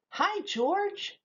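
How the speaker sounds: put-on high, bright little girl's hello; lightly voiced little girl calling a friend. A narrator's reading. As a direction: call out to George like a small girl. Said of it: "called out the little girl".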